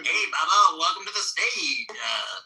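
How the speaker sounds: Andrew. Shown as a demonstration. Host welcoming a caller to the stage, high character voice. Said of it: "Can you do an Andrew?".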